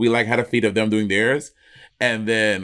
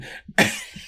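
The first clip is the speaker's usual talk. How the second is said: high laugh; 3 dB quieter than usual. Real, not acted. Laughing at funny scene.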